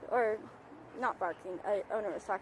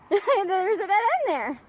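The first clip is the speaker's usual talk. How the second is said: laughing call; 9 semitones higher, 9 dB louder, much slower than usual. Real, not acted. Calling to exploring dog.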